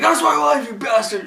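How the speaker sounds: high, loud enraged outburst; Triple H. Retold or imitated. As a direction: snap and yell it in a mock fury. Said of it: "Triple H snaps".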